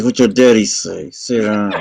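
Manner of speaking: imitating a scolding mother, teeth clenched; through gritted teeth. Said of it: "That's how my wife talked to my kids".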